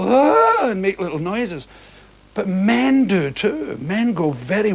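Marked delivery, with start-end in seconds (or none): 0.0-0.7 imitating women's swooning "Ah", pitched higher and louder; a playful mimicked sigh of admiration, "Ah"; mock swoon. Mimicking how women react when Sean enters a room, with a higher mock swoon. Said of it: "they, they go".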